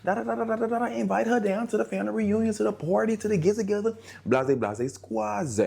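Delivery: very high teasing gossipy voice; acted quote. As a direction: squeal it like a nosy relative teasing. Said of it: "somebody that he knows".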